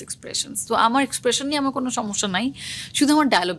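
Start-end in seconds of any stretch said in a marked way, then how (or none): 0.0-0.7 the word expressions breathed out as a soft unvoiced whisper; whispered echo. Shown as a demonstration. Actress explaining ad work to interviewer, whispered echo. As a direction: whisper expressions back to yourself. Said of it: "মানে, expressions".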